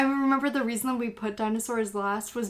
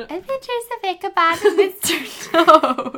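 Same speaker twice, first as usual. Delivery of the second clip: higher, louder alien voice, laughing; 5 semitones higher, 7 dB louder than usual. Pretend. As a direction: read the title as a giggly alien. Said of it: "you can do the alien".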